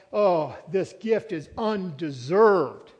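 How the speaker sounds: "undeserved" drawn out very slowly; stress on undeserved. Shown as a demonstration. Preacher stressing what kind of gift it is, "undeserved" stretched slow. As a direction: lean long and hard on "undeserved". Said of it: "that would be the emphasis of that word".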